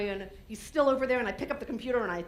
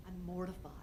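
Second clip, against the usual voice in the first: ordinary delivery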